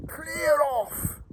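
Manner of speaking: high, mock yell of an angry neighbour; imitating a shouted clear off; retold yell. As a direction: throw it back like she yelled it. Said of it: "she shouted".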